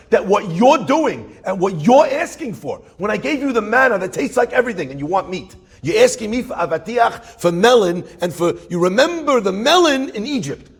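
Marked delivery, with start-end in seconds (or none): none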